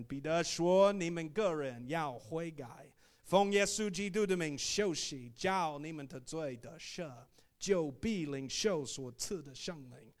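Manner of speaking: loud, forceful Mandarin sermon; preaching in Chinese. Shown as a demonstration. Missionary showing the church how he preaches Acts in Chinese, forceful preaching. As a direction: proclaim the verse at full force, drawing out phrases. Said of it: "we preach it the same way in Chinese".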